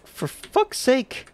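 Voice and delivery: high, slow, drawn-out exasperation; exasperated plea. Real, not acted. Streamer pleads with the game to let him play, slow exasperated whine. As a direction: stretch out the frustration. Game ruining his run.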